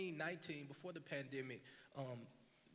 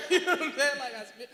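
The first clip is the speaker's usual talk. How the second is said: laughing; 10 semitones higher, 18 dB louder than usual. Real, not acted. Laughing at the memory.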